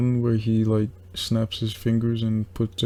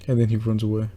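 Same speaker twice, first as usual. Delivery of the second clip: ordinary talk, nothing marked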